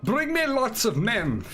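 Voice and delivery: high, slow mock battle cry; drawn-out call of a hammy commander; commander's shout. Commander demanding lots of men, high put-on shout.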